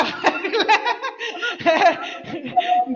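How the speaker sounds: long jeering laugh with rising pitch; mocking laugh. Real, not acted. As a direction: laugh at him to humiliate.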